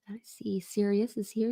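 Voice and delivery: hushed, breathy whisper. Real, not acted. Streamer noticing a viewer, whispered aside. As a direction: drop to a whisper as if not to wake someone.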